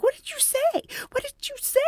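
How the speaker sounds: quietly aghast colleagues asking; alarmed whispers. Retold or imitated. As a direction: gasp it out as the stunned bystanders. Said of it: "looking at me like".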